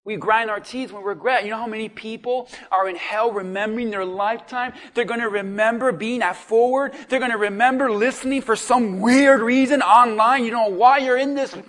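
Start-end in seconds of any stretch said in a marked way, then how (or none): 4.9-11.6 loud, higher impassioned preaching; fiery pulpit build; preaching at full force. Acted. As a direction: drive each line home to the congregation.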